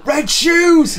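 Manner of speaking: high excited shout; written in capitals. Retold or imitated. Co-host reads a comment written in caps, high shout. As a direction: yell it as if it's all caps. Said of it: "in caps".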